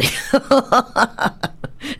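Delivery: long high cackle; real amused laugh. Real, not acted. Host amused by the aunt phone story, laughing. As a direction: burst out laughing at your own story.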